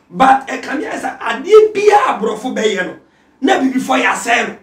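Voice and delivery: loud, high preaching shout; preaching at full force. Acted. Pastor exhorts listeners about social media, full-force preaching. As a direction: pastor building to a fervent shout.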